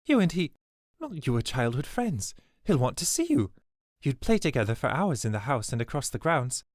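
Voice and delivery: put-on an excited old servant insisting; eager reminiscing. A narrator's reading. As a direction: press the memory on her hopefully. Swidona eagerly recalls childhood.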